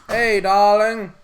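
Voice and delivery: slow, loud drawn-out hello; sweet greeting. Real, not acted. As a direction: sing out the greeting warmly. Greeting girlfriend on call.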